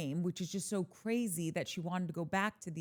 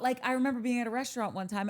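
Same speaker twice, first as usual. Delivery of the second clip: ordinary talk, nothing marked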